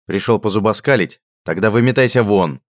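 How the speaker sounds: loud angry order out. A narrator's reading. Radgar throwing Richard out, loud anger. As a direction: drive the joker away. Hunter angrily throws friend out.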